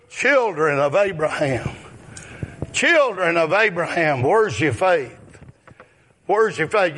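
ordinary talk

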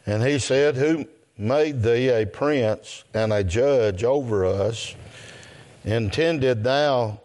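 measured, deliberate Bible reading to a congregation; scripture reading. Real, not acted. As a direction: read the verses out slowly and solemnly, phrase by phrase. Reads scripture aloud to congregation.

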